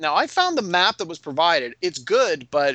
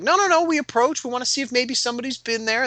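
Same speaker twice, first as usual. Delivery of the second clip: high, urgent retold protest; insistent objection; 10 semitones higher than usual. Players telling game master they want to approach, insistent. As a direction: cut in, high-pitched and insistent.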